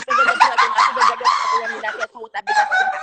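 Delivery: high, loud words through laughter; laughing shout. Real, not acted. Roasting a viewer on the live, shouting through laughter. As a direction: squeal it out while cracking up.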